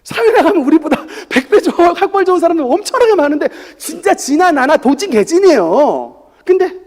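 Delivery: laughing high and loud. Real, not acted. Laughing aside on rank. (웃으며 크게 말하기)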